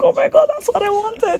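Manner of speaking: overjoyed cry. Retold or imitated. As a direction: burst out like the wish came true.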